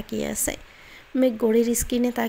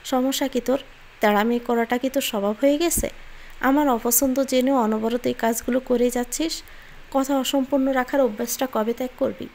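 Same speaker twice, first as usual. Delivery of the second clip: usual speaking voice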